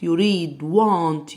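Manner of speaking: ordinary delivery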